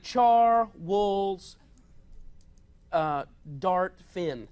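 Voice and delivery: slow, low, sounding it out; slow puzzling out. Shown as a demonstration. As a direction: read each syllable slowly. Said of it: "Charles Darwin".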